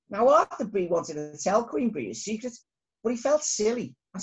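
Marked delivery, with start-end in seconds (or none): none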